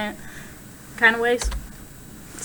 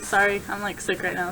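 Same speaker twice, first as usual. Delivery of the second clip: normal talking voice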